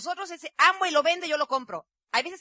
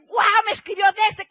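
loud, high "¡Guau!" bursting with excitement; excited exclaim; 8 semitones higher, 10 dB louder than usual. Retold or imitated. Husband telling his wife who wrote him, excited loud exclaim. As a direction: shout out the news like a thrilled husband.

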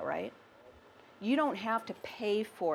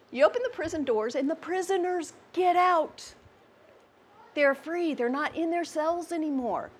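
higher, louder preaching build; soaring proclamation, higher and louder; rising preacher fervor; 6 semitones higher, 4 dB louder than usual. Acted. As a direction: lift the congregation with freedom. Preaching climax.

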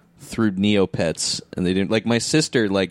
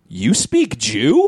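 put-on slow, loud, baffled kid; dumb kid voice; 6 semitones higher, 4 dB louder, much slower than usual. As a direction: drag it out, stunned. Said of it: "looked up at her and went".

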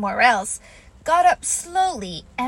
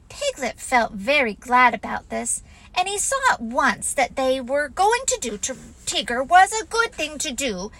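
usual speaking voice

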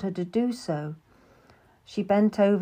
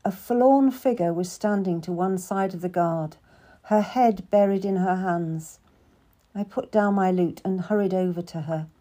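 ordinary delivery

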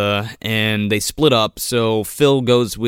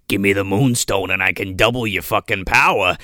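deep gravelly voice; 6 semitones lower than usual. Retold or imitated. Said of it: "this kind of deep, gravelly voice".

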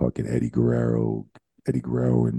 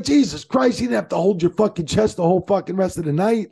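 much higher, louder mock rant; Bubba ranting; 4 dB louder than usual. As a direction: rant at him like an exasperated Bubba. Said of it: "He's like".

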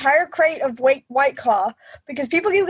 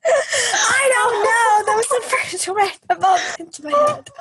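high, drawn-out wail through laughter; helpless laughter; 7 semitones higher than usual. Real, not acted. Friend defends her silly blurted answer, helplessly laughing. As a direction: laugh so hard the words stretch out high. Laughing fit over wrong Japanese.

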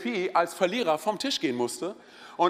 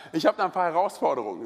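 usual speaking voice

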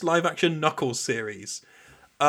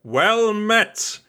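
game NPC voice; 8 semitones higher, 3 dB louder than usual. Retold or imitated. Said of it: "NPC lines from World of Warcraft".

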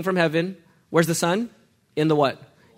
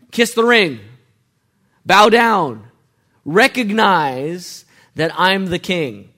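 commanding king; 3 semitones higher, 8 dB louder, much slower than usual. Pretend. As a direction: order them to bow like a king. Voicing a king demanding homage.

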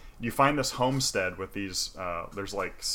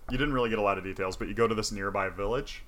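usual speaking voice

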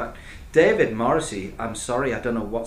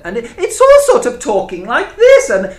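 much higher and louder, squealing through laughter; hammed-up Jane Horrocks; 13 dB louder than usual. Mocking the TV show's acting to co-hosts, high, loud, laughing caricature. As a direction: camp up a dithery lady character as a mocking send-up. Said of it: "that was a good impression of Jane Horrocks".

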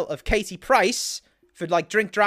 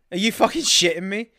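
normal talking voice

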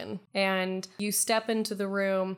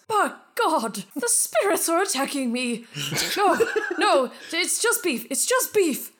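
much higher, louder startled cries; panicked miser; 7 semitones higher, 5 dB louder than usual. Acted. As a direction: panic as the spirits strike.

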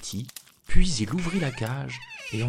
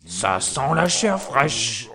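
slow booming giant roar; giant shouting; 4 dB louder, slower than usual. A narrator's reading. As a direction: bellow it like an ogre sniffing. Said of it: "en criant".

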